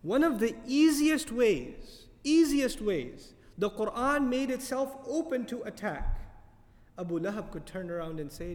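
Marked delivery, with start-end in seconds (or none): 0.0-3.3 higher, louder and slow, dwelling on easiest; slow emphatic. Real, not acted. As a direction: easiest ways drawn out and pressed hard. Stressing the Quran's open risk.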